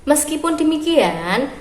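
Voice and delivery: usual speaking voice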